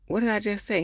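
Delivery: mock demanding question. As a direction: quote her pressing him for an answer. Acted retelling of an irritated question.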